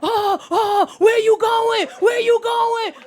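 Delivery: put-on young date panicking as her seat reclines; panicked yelling. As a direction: yell in alarm as the seat tips back. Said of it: "He start to panic".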